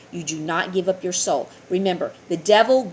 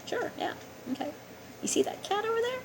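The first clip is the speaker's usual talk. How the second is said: high, eerie, breathy devil bargaining; devil's voice; 9 dB quieter, faster than usual. Acted. The devil answering a person who asks him for things, high and eerie. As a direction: slither it out like the devil making a deal. Voicing the devil making a deal.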